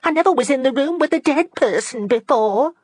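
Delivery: put-on high, frightened young lady. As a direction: say it in horror. Fanny frightened by body.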